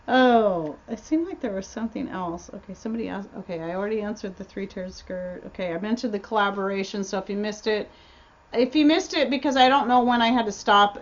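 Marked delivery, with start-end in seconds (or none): none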